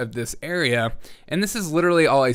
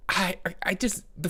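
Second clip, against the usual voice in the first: whispering; 4 semitones higher, 6 dB quieter than usual. Real, not acted. Whispered trailing.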